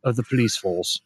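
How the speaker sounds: weary, low English burnout cop; English accent. Acted. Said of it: "English accent".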